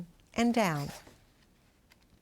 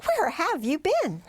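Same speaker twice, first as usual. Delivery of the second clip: exclaimed; 8 semitones higher, 3 dB louder than usual. A narrator's reading. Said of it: "Grandma exclaimed".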